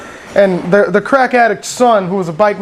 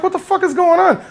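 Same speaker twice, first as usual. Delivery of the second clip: outraged; 10 semitones higher than usual. Retold or imitated. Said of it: "And I was like".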